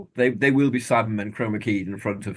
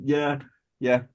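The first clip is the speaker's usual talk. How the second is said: ordinary talk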